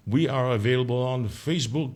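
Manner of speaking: ordinary talk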